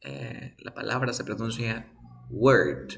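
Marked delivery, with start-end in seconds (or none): 2.3-2.9 "word" with an E-type vowel; teacher models "word" with an E sound. Shown as a demonstration. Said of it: "se pronuncian con la misma vocal".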